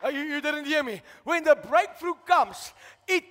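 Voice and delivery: loud, driving preacher's call; full-force preaching. Acted. Preacher rousing the church about blessing, loud and fervent. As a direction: preach it hard to the congregation.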